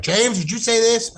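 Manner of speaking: mimicking mom. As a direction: play the questioning mother, drawn out. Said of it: "She's like".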